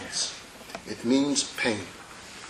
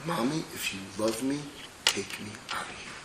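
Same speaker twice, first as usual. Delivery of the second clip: put-on quiet pleading baby; 2 semitones lower, 10 dB quieter than usual. Baby begs mommy.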